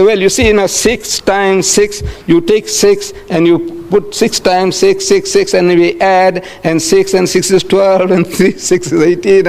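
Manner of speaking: slow, drawn-out stalling while working it out; fumbling for the answer. Acted. Pupil with no ready answer to a sum, fumbling. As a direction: think aloud, stretching each step to buy time. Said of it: "you are fumbling for the answer".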